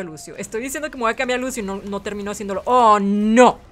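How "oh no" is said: loud, drawn-out groan of dismay; dismayed shout. Real, not acted. Player killed mid-sentence, dismayed shout. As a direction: shout it in frustration. Killed before switching character.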